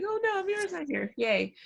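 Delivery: jokey fake dismay turning to a dry yay; mock dismay. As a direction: pitch shoots high, fast fake alarm. Joke about a student's absence.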